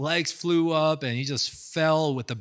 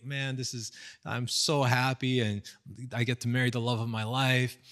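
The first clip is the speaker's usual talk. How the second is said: ordinary talk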